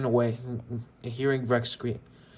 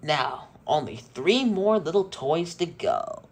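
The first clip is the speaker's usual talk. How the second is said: slow, higher, altered creepy voice; sinister villain; 4 semitones higher, slower than usual. Acted. Tex gloating to the remaining toys, slow and creepy. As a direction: savor the threat like a toy killer.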